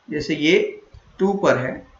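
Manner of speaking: ordinary delivery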